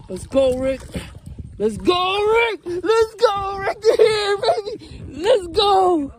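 straining while reeling in; excited effort, reeling in hard; fighting a fish. Real, not acted.

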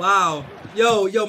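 loud, excited yo yo; excited shout. Real, not acted. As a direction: shout in hype at the play. Reacting to a big play.

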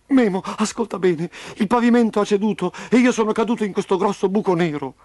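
put-on high, plaintive child's voice; tearful explanation. A narrator's reading. Trapped Roby telling Memo of his fall, tearful. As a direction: explain it as if near tears. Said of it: "Lo Scuro stava piangendo di nuovo".

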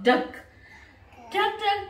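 ordinary delivery

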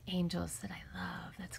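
soft, tender whisper. Real, not acted. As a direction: whisper it like a secret. Fond whisper about angel card.

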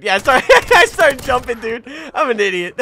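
high, loud, laughing through the words; real laughing outburst. Real, not acted. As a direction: crack up loudly at your own blunder. Cracking up at own mistake.

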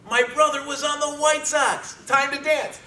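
high, loud cheering; joyful shout. Retold or imitated. Sibling celebrating the draft pick, joyful shout. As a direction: whoop it like you just heard the news.

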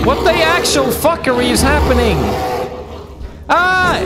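freaking out mid-fight; alarmed high shouting at the bear; panicked yelling. Real, not acted.

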